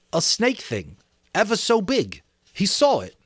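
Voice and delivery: lightly voiced schoolboy explaining; Piggy interpreting to the assembly; boy Piggy, earnest. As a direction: speak for the frightened child.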